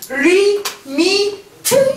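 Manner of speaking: 리미트 slowly, one syllable at a time; syllable-by-syllable reading. Shown as a demonstration. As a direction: stretch out each syllable of 리미트. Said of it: "이렇게 읽습니다".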